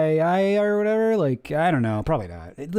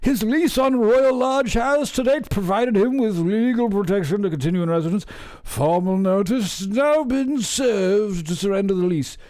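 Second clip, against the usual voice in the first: inbred royal voice; 5 semitones higher than usual. Pretend. Mocking the royals while reading, hammed-up posh voice. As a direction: read it like a stuffy inbred aristocrat. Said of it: "my voice is becoming more inbred".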